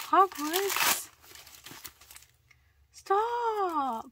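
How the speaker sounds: high, loud fangirl outburst; thrilled disbelief. Real, not acted. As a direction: cry out in delighted shock at a gift. Thrilled by gift in package.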